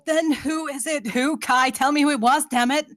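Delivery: loud, desperate pleading; frantic demand. Acted. Charlie demands Kai tell her who was shot, loud and desperate.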